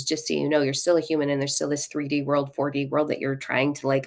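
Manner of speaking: normal talking voice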